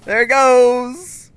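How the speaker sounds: loud, slow, high yell; real surprised yell; excited shout. Real, not acted. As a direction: shout as it takes off. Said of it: "gunship appears".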